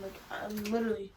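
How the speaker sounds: normal talking voice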